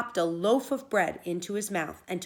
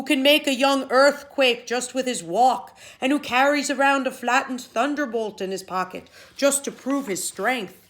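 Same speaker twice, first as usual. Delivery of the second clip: loud, higher, fretful giant; a big man too scared to fight; frightened Finn; 5 semitones higher, 9 dB louder than usual. Acted. Finn voiced, fearful giant.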